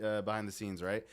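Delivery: ordinary delivery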